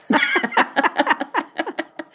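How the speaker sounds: high burst of laughter; real high laugh; big laugh. Real, not acted. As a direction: crack up laughing. Laughing at the punchline.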